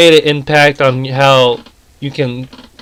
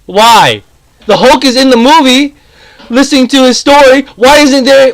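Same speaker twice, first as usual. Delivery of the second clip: heated rant; 6 semitones higher than usual. Real, not acted. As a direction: build the outrage through the comparison. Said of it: "I'm about to get really pissed off".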